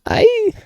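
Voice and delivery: very high, thin squeal; short high-pitched mock scream. Shown as a demonstration. Reader showing how they scream, high mock scream. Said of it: "that's how I sound when I scream".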